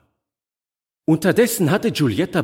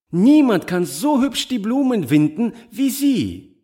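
high, joyful praise; Giulietta, cheerful; 10 semitones higher than usual. A narrator's reading. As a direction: exclaim happily in a high voice. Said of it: "rief Giulietta fröhlich". (fröhlich und hell ausgerufen)

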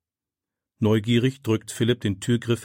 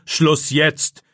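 loud, raised cut-off order; commanding; 5 semitones higher, 5 dB louder than usual. A narrator's reading. Teacher silences the protesting pupil, commanding. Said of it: "befiehlt Herr Wagner".

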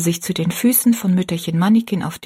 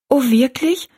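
happy surprise; 7 semitones higher than usual. A narrator's reading. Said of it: "sagte Rosalie".

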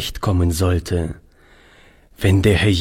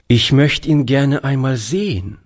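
put-on higher, wistful young boy's voice; thoughtful boy; 6 semitones higher than usual. A narrator's reading. Boy Toni wishing to see the Master, thoughtful and higher. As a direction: muse it aloud like a dreamy child. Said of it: "bemerkte Toni nachdenklich".

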